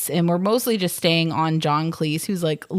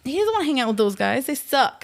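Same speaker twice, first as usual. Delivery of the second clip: mock dismissive voice; 7 semitones higher, faster than usual. Voicing Arthur's attitude.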